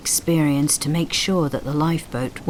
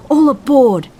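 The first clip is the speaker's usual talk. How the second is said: very loud, high yell; full-throated shout; 9 semitones higher, 10 dB louder than usual. A narrator's reading. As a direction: yell it so the whole crew hears. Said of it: "came the yell".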